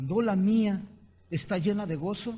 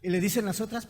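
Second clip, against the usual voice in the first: normal talking voice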